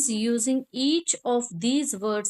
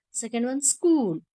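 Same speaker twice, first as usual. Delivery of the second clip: ordinary delivery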